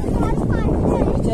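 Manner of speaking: long drawn-out high call across the field; calling out a name. Real, not acted. Father calls his daughter across the farm, long loud call.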